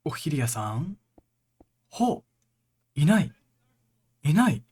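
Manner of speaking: tense, calling out nervously; scared horror game streamer. Real, not acted. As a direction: call out into the dark, scared. Searching for Ophelia in horror game.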